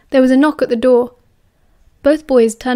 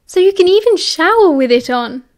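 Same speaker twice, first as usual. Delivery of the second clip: high, cheery and playful; cheerful teasing; 7 semitones higher, 4 dB louder than usual. A narrator's reading. Sirius teases Remus about showering in the hat, cheerfully. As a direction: tease with a wink in the voice. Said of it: "Sirius explained cheerfully".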